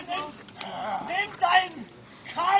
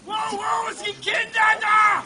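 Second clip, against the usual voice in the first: higher shouted warning; alarmed shout; 4 semitones higher than usual. Acted. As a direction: shout out to warn everyone.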